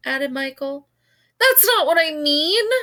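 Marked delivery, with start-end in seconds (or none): 1.4-2.7 high, loud screech. A narrator's reading. Kaya stomping her foot at Michael, high loud screech. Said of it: "screeched Kaya".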